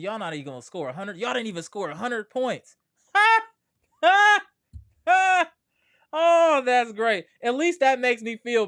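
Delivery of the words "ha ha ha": loud high barking laugh; mocking laugh. Real, not acted. Streamer laughing at Boston's score, loud mocking ha. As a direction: cackle at their misery.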